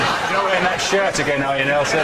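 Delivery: playful mocking friend, rising with laughter; ribbing a buddy about his shirt, laughing; teasing friend. Friend teasing Mandela on the phone about his shirt. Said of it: "friend teasing Mandela on phone".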